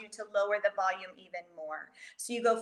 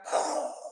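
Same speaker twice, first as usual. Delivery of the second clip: quick startled intake of breath; gasping as the Lego set crashes; sharp gasp. Pretend. Crash makes listener gasp.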